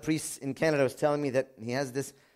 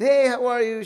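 bright greeting; 8 semitones higher, 7 dB louder than usual. Retold or imitated. Retold friendly greeting.

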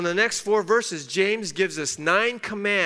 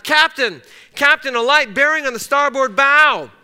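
higher, louder urgent call to the captain; retold lookout's shout; 7 semitones higher, 10 dB louder than usual. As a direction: sound the alarm from the bridge. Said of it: "suddenly shouted".

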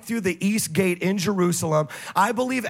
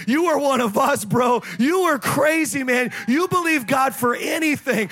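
loud, higher hyped-up praise; excited shout; 5 semitones higher, 4 dB louder than usual. Retold or imitated. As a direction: cheer him on like a teammate. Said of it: "high five me and be like".

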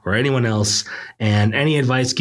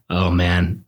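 ordinary delivery

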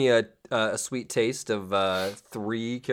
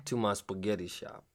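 mobster; 3 semitones lower, 8 dB quieter than usual. Pretend. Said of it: "he's a mobster".

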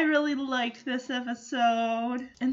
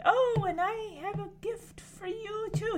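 high, softer pretend assurance; improvised cover-up; 8 semitones higher, 6 dB quieter than usual. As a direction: act like the gift was planned. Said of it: "He's pulling a Jackson right now".

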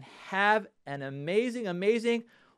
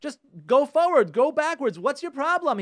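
much higher, louder exasperated imitation; upset husband; 9 semitones higher, 7 dB louder than usual. Husband telling his wife how to steer out of snow, imitated angry exasperation. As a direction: act out a husband losing patience, hammering each order. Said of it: "And he's upset".

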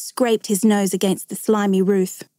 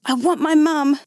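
put-on scared child's plea, pitched higher; frightened cry; 6 semitones higher than usual. A narrator's reading. Trapped boy wants his mother, frightened cry. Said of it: "he cried".

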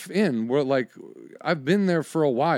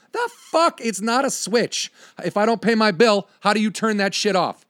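much higher and louder, indignant; incredulous outburst; 6 semitones higher, 3 dB louder than usual. Retold or imitated. Customer to DirecTV agent, incredulous and angry. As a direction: blow up at the agent in disbelief. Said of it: "I go".

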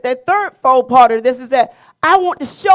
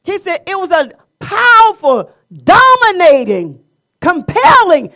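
higher, louder sermon voice with punched adjectives; forceful preaching; 5 semitones higher, 6 dB louder than usual. Real, not acted. Preacher describing God's trumpet-blast voice to worshipers, forceful preaching. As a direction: hammer each describing word to the congregation.

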